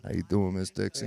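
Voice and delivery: sultry voice. Pretend. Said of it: "Was that your most sultry voice?".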